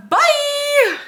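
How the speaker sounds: high loud bye; sing-song sign-off; chirpy goodbye. Real, not acted. Sign-off shout.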